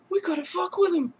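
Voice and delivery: high sly voice; scheming brother. Retold or imitated. Brother plans to mess with Rocco, high sly voice. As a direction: plot the prank gleefully. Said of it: "And he's like".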